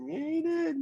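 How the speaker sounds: high, soft, teasing retort; sing-song put-down that calls his bluff; mock high-pitched comeback. Mocking artists who deny work.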